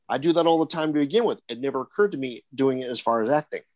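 ordinary talk, nothing marked

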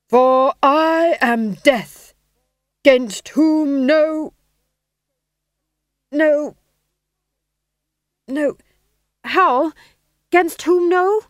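very slow, loud, high stage Death, forgetting lines; hammed-up Death. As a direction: boom each word like a ham actor. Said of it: "his first speaking part".

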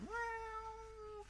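high, soft meow; cat meow. Retold or imitated. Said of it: "I'm just going".

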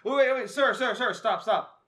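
high, loud protest; mock alarm. Imagined passer-by fending off a creepy stranger, mock-alarmed. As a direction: fend off a creep in mock panic.

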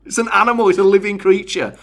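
high-pitched indignant retort; indignantly sticking up for a pet; annoyed. Retold or imitated. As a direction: retell an annoyed protest, pitch shooting up. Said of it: "So I was really annoyed".

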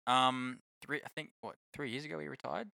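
ordinary talk, nothing marked

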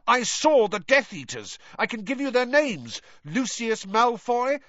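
high, urgent shouted insistence; shouting. A narrator's reading. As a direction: call out the accusation, pitched high. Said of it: "Harry shouted".

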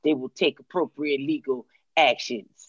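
ordinary talk, nothing marked

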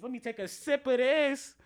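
high, loud playful aside. Real, not acted. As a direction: call out the pause for a drink. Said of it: "Hold on, let me take a sip".

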